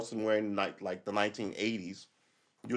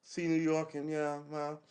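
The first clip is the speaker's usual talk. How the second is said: higher-pitched, lightly voiced complaint; a put-on wistful protest; voicing younger Russians; 5 semitones higher than usual. Ordinary Russians pining for America, lightly voiced protest. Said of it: "all the people are going".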